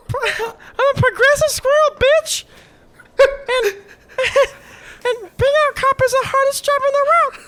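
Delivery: high, squeaky squirrel rant. Acted. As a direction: rant like an indignant cartoon squirrel. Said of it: "the essence of the squirrel comes out".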